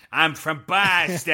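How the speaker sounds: hammed-up Boston accent, words dragged out slowly. Podcaster mocking an actor who showed up with an accent, broad Boston accent. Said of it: "Oh, that accent".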